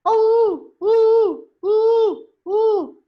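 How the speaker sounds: very high, loud owl hoots; owl hooting. Acted. Owl flying out at night, hooting. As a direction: hoot long and piercing like an owl. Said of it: "The owl sound is called hooting".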